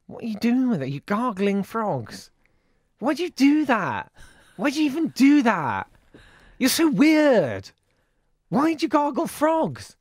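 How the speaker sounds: high, loud mock disbelief; incredulous teasing. Real, not acted. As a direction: tease them, pitch soaring in disbelief.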